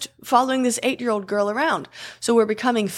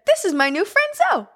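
put-on excited little girl; 13 semitones higher than usual. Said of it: "And Sophia said".

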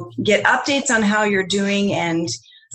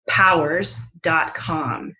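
ordinary talk, nothing marked

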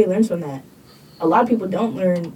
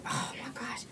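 breathy whisper to herself; 15 dB quieter than usual. Real, not acted. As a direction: whisper it, embarrassed. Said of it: "I'm gonna sound like a villain".

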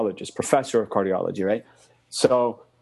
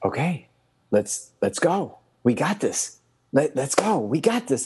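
higher, rallying pep talk; encouraging; 4 semitones higher, slower than usual. Retold or imitated. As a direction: fire a friend up. Rallying friend in story.

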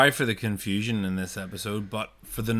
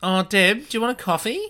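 friendly offer; 11 semitones higher, 6 dB louder than usual. Retold or imitated. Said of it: "someone goes".

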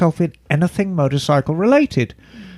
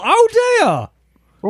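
high, drawn-out oh dear; a hammed-up gasp of pity; mock dismay. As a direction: clutch your pearls in a high voice. Mock reaction while reading the letter.

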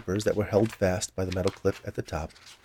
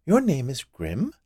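higher, drawn-out question; curious; 5 semitones higher, slower than usual. A narrator's reading. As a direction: ask slowly with a lifted, curious voice.

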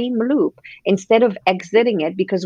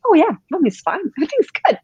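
high fake-fine answer with a nervous laugh; mock-breezy mommy's fine; forced cheerful; 10 semitones higher, faster than usual. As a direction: chirp it out, hiding the hurt behind a laugh. Fake cheerful mom reply.

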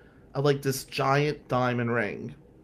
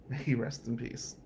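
ordinary talk

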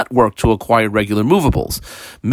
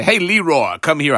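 calling out; 4 semitones higher than usual. Retold or imitated. Teacher voices calling a slave.

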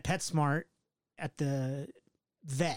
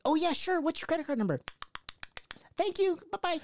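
high, sweet customer-service voice; chirpy clerk voice; 12 semitones higher than usual. Retold or imitated. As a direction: play the cheerful receptionist. Voicing the vet clerk.

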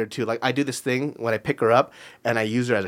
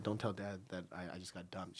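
softer, hushed plea fading out; pleading to mom; 5 semitones lower, 21 dB quieter than usual. Retold or imitated. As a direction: beg mom quietly not to tell. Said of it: "I was crying and stuff".

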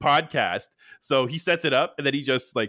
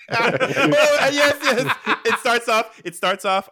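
excited; 9 semitones higher, 5 dB louder, slower than usual. Real, not acted. Excited pitching a sequel.

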